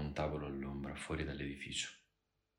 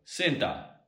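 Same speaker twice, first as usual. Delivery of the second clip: raised voice to get attention; shout; 5 semitones higher, 8 dB louder than usual. A narrator's reading. Man hailing the barwoman through the curtain, shouted. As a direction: call out sharply, high and loud. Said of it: "L'uomo gridò".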